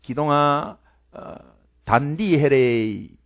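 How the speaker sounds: soft, slow Gyeongsang mother calling 기동아 단디해래; a mother gently warning her son to be careful; mother's dialect warning. Retold or imitated. Preacher imitating his mother, soft and slow. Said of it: "어머니가 자주 쓰는 단어".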